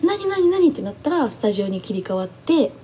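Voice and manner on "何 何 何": excited bewilderment. Retold or imitated. Overwhelmed by the scene.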